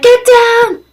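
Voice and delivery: high yelled command; panicked shout. Retold or imitated. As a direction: yell it as the attack starts. Astronauts freaking out.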